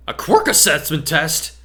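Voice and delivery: loud, slow, high cry of dismay; outcry. Acted. Class crying out at a surprise test, loud and drawn out. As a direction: shout it out in protest. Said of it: "The cry went up".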